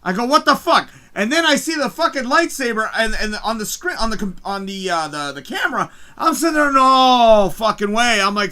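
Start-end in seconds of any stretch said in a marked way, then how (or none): 0.2-0.9 retold higher, louder disbelief; losing it. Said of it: "I literally start losing my shit". 6.7-8.2 loud, drawn-out cry of disbelief; losing it. Retold or imitated. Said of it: "I'm literally, literally losing my shit".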